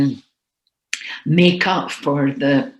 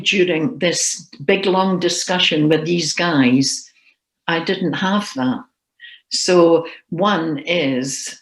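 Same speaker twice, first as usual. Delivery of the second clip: ordinary delivery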